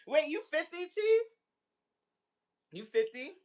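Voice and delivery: ordinary delivery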